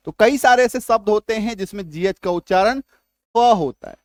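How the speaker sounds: ordinary delivery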